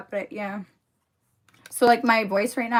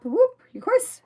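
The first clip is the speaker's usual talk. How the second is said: sarcastic whoop; 7 dB louder, slower than usual. Real, not acted. Viewer says from Europe.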